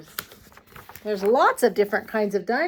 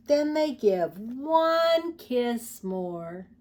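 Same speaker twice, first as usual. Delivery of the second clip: tender; 3 dB quieter, slower than usual. Acted. Tender bedtime close.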